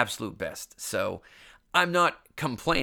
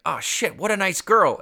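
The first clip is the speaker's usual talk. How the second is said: higher and louder, a penny-drop yelp; the same words as a horrified dawning; higher, louder oh-shit realization; sudden realization; 8 semitones higher, 7 dB louder than usual. Retold or imitated. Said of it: "And then it occurs to me".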